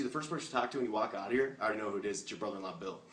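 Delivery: normal talking voice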